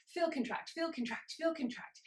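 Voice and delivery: racing heartbeat. Shown as a demonstration. Instructor racing to match an anxious heart, fast high chant. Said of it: "I can't even say that fast enough".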